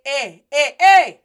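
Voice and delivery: punchy, rising-energy eh; attention-getting call. Shown as a demonstration. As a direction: call out to get someone's attention. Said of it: "Go ahead and say".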